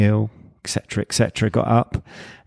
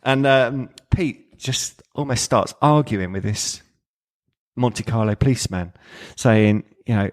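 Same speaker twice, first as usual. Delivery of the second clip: ordinary talk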